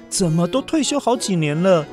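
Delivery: usual speaking voice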